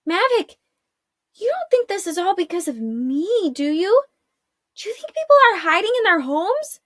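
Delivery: put-on high, anxious girl's voice; a frightened girl pleading with her friend; worried young girl. A narrator's reading.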